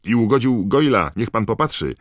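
loud, high, urgent complaint; shouted accusation. A narrator's reading. Pupil points out Goyle to Snape, shouted accusation. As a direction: demand that the teacher look.

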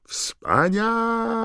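very high, drawn-out triumphant yell; announcer's ecstatic cry to the whole stadium; Bagman shouting. A narrator's reading. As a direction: stretch it into a high, loud cheer. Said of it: "krzyczał Bagman".

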